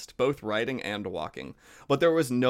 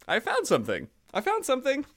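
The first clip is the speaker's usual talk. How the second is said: excited cry; 13 semitones higher than usual. A narrator's reading. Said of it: "he cried".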